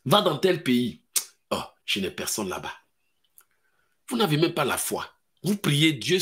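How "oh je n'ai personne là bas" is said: low, soft whining excuse; mock excuse. Believer refuses God's call abroad, low soft whine. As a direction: plead you have nobody there.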